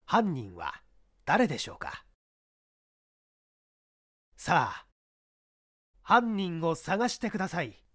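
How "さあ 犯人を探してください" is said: ordinary delivery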